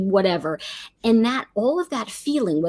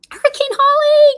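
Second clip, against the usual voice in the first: excited shout-out; 8 dB louder than usual. Real, not acted. Host greeting a viewer who joined the stream, excited call. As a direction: sing out the name in delight.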